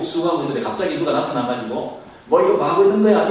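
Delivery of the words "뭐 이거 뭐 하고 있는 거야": mock rebuke. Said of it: "이런 책망 쪽의 말".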